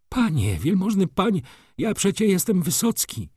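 high, loud, frantic railwayman; frightened pleading. Acted. Railwayman tells his attacker who he is, frightened pleading.

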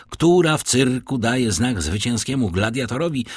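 ordinary delivery

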